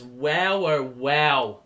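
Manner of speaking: ordinary delivery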